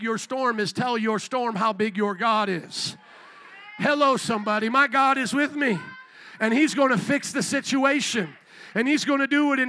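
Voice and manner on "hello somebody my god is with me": normal talking voice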